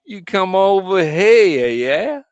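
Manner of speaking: loud, high, drawn-out West Coast American; hammed-up West Coast tough-guy accent. Shown as a demonstration. Brit mimicking Brits doing Americans, hammed-up West Coast accent. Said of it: "West Coast".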